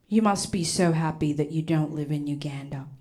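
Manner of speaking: soft condescending whisper; snooty boy. Retold or imitated. Stuart talking down to the African girl, soft condescending whisper. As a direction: talk down to her quietly. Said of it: "he was just like".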